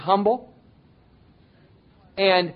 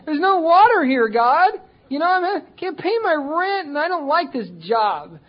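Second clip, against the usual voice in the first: much higher and louder mock whine; whining complaint; 11 semitones higher, 5 dB louder than usual. Preacher imitating a believer whining to God about rent and job. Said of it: "we start this whining".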